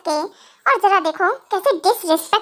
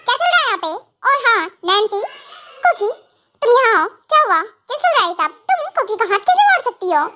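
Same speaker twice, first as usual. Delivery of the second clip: ordinary talk, nothing marked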